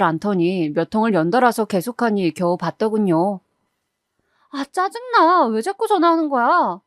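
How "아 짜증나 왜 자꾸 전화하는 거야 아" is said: high, whiny annoyed daughter; irritated. Acted. Daughter answering her mother's persistent calls, irritated. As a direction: pick up thoroughly fed up, pitch raised.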